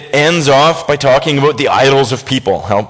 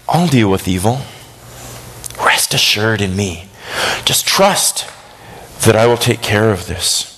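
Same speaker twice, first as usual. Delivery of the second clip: low, unhurried reassurance with pauses; calm, reassuring; 4 semitones lower, 3 dB quieter than usual. Acted. God promises to deal with evil, reassuring. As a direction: soothe as a calm, assured God. God calmly answers worked-up believers.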